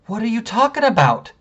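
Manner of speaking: high-pitched, exasperated question; retold incredulous challenge. Husband retelling his reply to the cashier, incredulous. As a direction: throw it back in disbelief.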